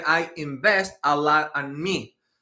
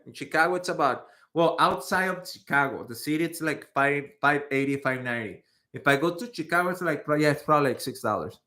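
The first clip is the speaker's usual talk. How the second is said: normal talking voice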